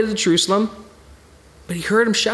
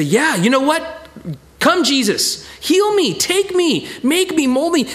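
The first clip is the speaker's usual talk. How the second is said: bold cry to Jesus; 6 semitones higher, slower than usual. Retold or imitated. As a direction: call out boldly, no holding back. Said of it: "go for Jesus".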